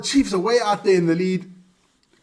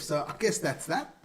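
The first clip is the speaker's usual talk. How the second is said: normal talking voice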